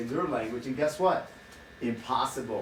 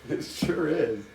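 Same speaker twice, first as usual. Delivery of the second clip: ordinary talk, nothing marked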